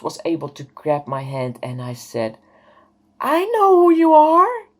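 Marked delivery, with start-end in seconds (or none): 3.2-4.7 very high, loud, sustained retold exclamation; overwhelmed, ringing cry of realization; cry of recognition. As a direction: call it out on one high held pitch. Reliving her cry of recognition.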